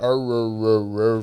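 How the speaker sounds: repeated drawn-out "er" noise; imitated r-heavy accent. Owner imitating his dog's Pennsylvania accent, growly string of "er"s. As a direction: mimic the dog's accent as rolling r's. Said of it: "He does do the".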